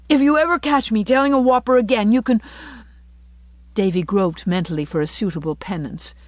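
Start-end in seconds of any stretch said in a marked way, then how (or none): 0.1-2.4 put-on high, loud, insistent boy; tearful childish vow, pressed hard; between sobs. A narrator's reading. Davy swears off whoppers to Anne, acted tearful. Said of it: "asseverated Davy between sobs".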